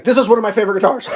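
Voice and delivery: fast, high, loud gushing; excited mock fan with a laugh; imitated enthusiasm. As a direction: rush it out like a delighted owner, laughing. Quotes gushing forum owner, breaking into laughter.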